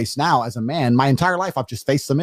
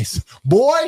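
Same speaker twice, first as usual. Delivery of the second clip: much higher, louder mock dad voice; mock stern dad; 11 semitones higher, 3 dB louder than usual. Future dad tells scared son to face dark, hammed-up. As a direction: ham up a tough father laying down the law. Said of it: "I'm gonna say".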